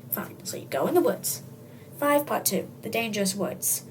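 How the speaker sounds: ordinary delivery, nothing marked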